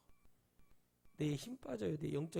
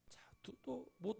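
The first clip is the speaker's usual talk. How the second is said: whisper; 6 semitones higher, 9 dB quieter, slower than usual. Real, not acted. Hushed aside.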